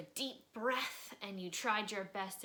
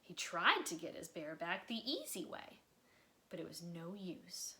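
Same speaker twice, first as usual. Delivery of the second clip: expressive read-aloud storytelling; lively children's book narrator; story narration; slower than usual. A narrator's reading. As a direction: read it like bedtime story time for kids. Reading a picture book aloud.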